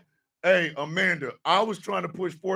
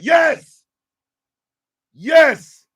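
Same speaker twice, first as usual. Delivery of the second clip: loud, high, punched yes; big high-pitched exclamation; emphatic agreement; 8 semitones higher, 10 dB louder than usual. Real, not acted. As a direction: shout it in total agreement. Emphatic agreement with a viewer comment.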